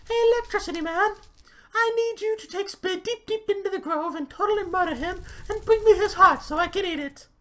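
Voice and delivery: very high, loud squeal; queen's squeaky command. Acted. Queen commands the huntsman, very high and loud. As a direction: order the murder in a shrill cartoon queen voice.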